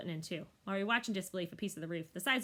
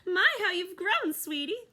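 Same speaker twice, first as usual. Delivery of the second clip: gushing granny cat; 11 semitones higher, 7 dB louder, slower than usual. Acted. Snickers greets Ruby fondly.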